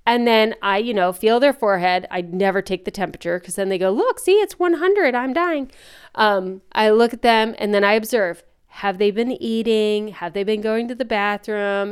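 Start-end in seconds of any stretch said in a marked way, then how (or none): 3.9-5.7 much higher, mock-whiny child insisting on being sick; whiny kid. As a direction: play a dramatic kid showing off a fever. Kids claiming fever, mom imitates.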